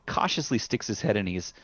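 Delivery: ordinary talk, nothing marked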